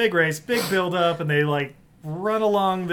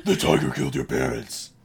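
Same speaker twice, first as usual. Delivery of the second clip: mock Batman voice; 5 semitones lower than usual. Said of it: "that was a different Batman".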